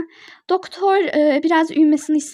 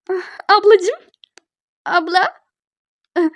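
higher, rising happy call; delighted greeting; 4 semitones higher, slower than usual. Acted. Little patient greeting the arriving big sister, delighted and high.

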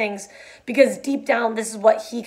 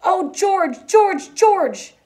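high wailing call, getting louder; distressed, high-pitched calling; anguished cry; 9 semitones higher, 5 dB louder, much slower than usual. A narrator's reading. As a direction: cry the name out desperately, pitch high and falling. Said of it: "And he cried".

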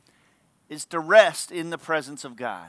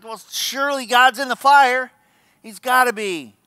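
raised, louder burst of expectation; excited certainty; 6 semitones higher, 6 dB louder than usual. Acted. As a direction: act utterly convinced, pitch up. Acting Elijah's eager expectation.

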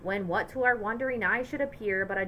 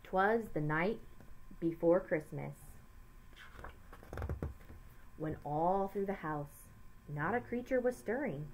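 poem recital; 5 semitones lower, 8 dB quieter than usual. A narrator's reading. Begins reading the poem.

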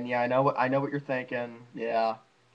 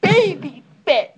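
mock announcer; 8 semitones higher, 7 dB louder, slower than usual.

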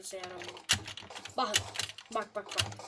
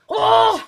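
loud high cry of relief; victory yell; triumphant shout; 8 semitones higher, 14 dB louder than usual. Real, not acted. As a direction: let it out like you finally won. Relief after finishing level.

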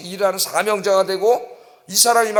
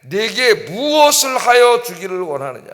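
loud, slow, solemnly drawn-out question; Jesus; 5 dB louder, slower than usual. Acted. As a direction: put the question slowly and weightily. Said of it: "예수님 묻습니다".

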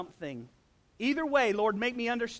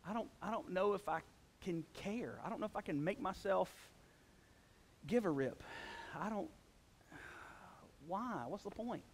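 soft, flat-out low-energy muttering that fades away; depressed, listless; 3 semitones lower, 10 dB quieter than usual. Retold or imitated. Pastor voicing a depressed believer who has given up, fading softer. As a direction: sound like you can barely care anymore. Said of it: "the slow blah of depression".

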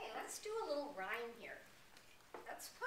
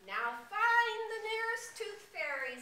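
high, loud storybook order; mock-royal call, pitched high; little prince commanding; 11 semitones higher, 9 dB louder than usual. Little prince sends for the tooth fairy, commanding loudly.

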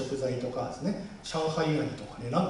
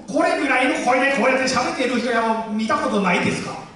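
higher and louder demo voice; 高い声の実演; 8 semitones higher, 8 dB louder than usual. Shown as a demonstration. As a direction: show the raised Chinese-accented register. (高く大きな声で実演)

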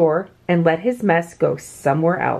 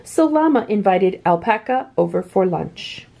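plain reading voice

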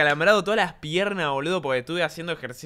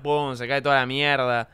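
usual speaking voice

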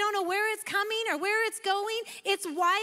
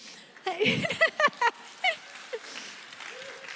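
self-conscious laugh; 8 semitones higher than usual. Real, not acted. Laughs at own intensity.

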